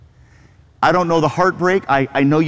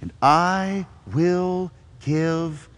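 softer, slowing to a breathed last word; gentle invitation; 3 semitones lower, 4 dB quieter, slower than usual. Retold or imitated. Pastor gives Jesus's invitation to the weary, soft and slow. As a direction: invite them into rest. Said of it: "Jesus says".

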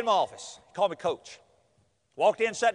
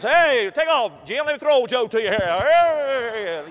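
loud, drawn-out football call; mock calling out; 3 semitones higher, 7 dB louder, much slower than usual. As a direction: holler slowly as if throwing a long pass. Men tossing baby like a football.

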